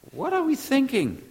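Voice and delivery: incredulous. Real, not acted. Rhetorical outburst.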